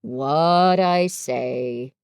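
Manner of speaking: very low, very slow donkey voice; Eeyore, gloomy. Acted.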